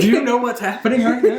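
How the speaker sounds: mock incredulity. Joins in the retold fans' retort.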